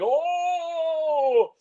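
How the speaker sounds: high squeaky yelp; mock yelp. Imitating Bond on the fire truck ladder with a high yelp. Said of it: "he's going".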